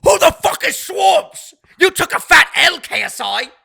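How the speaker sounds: loud, high, slow British accent mimicry of a boxer; hammed-up British accent, mocking a rival; British accent impression. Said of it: "this in his deep British accent".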